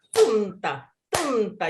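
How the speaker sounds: tumta chanted strong then weak; trochaic beat. Shown as a demonstration. Teacher demonstrating the reversed trochaic beat, chanting tumta. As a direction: stamp the TUM, drop the ta. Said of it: "stressed followed by the unstressed".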